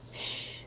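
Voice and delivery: shushing. Acted. Hushing puppy in the library.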